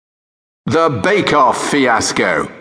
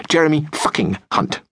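normal talking voice

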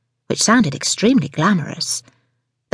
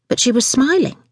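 ordinary delivery

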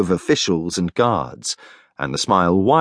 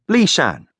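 ordinary delivery, nothing marked

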